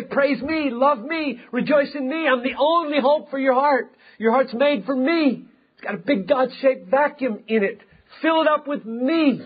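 high, urgent divine appeal; impassioned self-exalting God. Acted. Preacher speaks as God calling hearts to Himself, impassioned. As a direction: plead as God, pitched well up throughout. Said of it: "He has to say".

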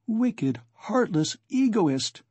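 high, slow indignant outburst; indignant cry. A narrator's reading. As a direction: spit out the accusation in outrage. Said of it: "cried Donya".

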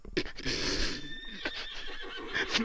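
very high airy laugh that rises; helpless squeaky laugh; breathless high giggle; wheezing squeal of laughter. Real, not acted. Laughing at the game.

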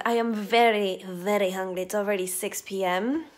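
usual speaking voice

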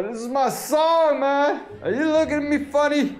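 put-on high, carried-away drunken outburst; rowdy drunk. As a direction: play a drunk getting worked up. Drunk act, song then challenge.

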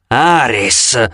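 growled. A narrator's reading.

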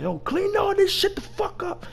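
high-pitched mock anger; imitating angry boss. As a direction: order them about like a boss losing it. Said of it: "Joe start getting mad".

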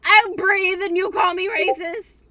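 high, very loud, drawn-out protest; mock outraged shout. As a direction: yell it slowly in exaggerated indignation. Mock outrage in teasing argument.

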